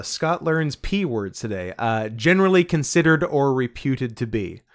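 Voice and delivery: normal talking voice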